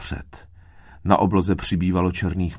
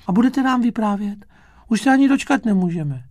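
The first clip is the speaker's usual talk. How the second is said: very high, excited little voice; eager bug children; 5 dB louder, faster than usual. A narrator's reading. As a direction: beg for a story like impatient children. Impatient bug children beg story.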